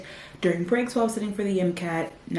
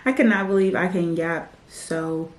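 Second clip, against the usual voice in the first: ordinary talk, nothing marked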